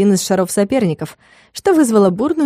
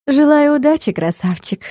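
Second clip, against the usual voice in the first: languid purr; 6 semitones higher than usual. Acted. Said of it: "томно протянула она".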